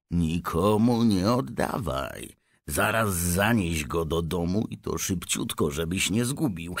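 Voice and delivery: usual speaking voice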